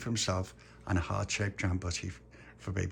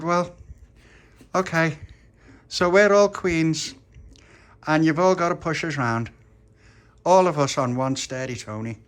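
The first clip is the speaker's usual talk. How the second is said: put-on loud, raised child queen voice; bossy little Dolores; 8 semitones higher, 7 dB louder than usual. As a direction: declare it firmly, with weighty pauses.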